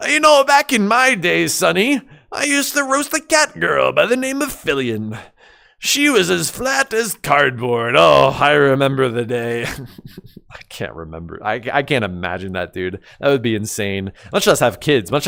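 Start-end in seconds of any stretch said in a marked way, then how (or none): 0.1-9.6 creaky grandpa reminiscing slowly; very old man. Retold or imitated. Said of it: "just describing to my grandchildren".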